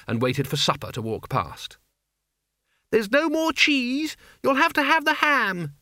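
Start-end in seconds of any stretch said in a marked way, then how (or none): 2.9-5.7 very high, slow character voice; high, lilting young woman; Isabel's girlish voice. Acted. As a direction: pitch it way up and draw it out. Narrator voices Isabel talking to Mort.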